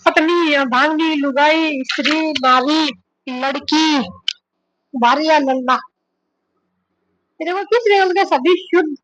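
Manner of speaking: ordinary delivery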